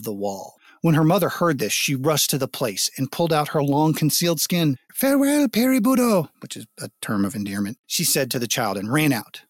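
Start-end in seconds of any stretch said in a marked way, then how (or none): 4.9-6.3 high, ringing farewell; joyful farewell. A narrator's reading. Selkie mother bidding her child farewell, high and joyful. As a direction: call it out, free at last.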